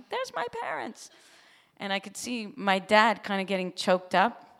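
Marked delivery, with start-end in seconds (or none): 0.1-1.1 high, sing-song presenting voice; playful stage reveal; proud reveal. Retold or imitated. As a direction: show them off with a flourish. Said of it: "I'm like".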